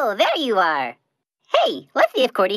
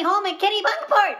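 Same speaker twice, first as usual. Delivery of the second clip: breaking into tears; 6 semitones higher than usual. Acted. Rambling breaks into crying.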